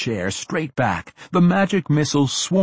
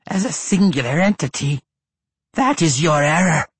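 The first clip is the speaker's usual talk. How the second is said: ancient lich voice; 5 semitones higher, 5 dB louder than usual. A narrator's reading. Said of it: "the Lich explained".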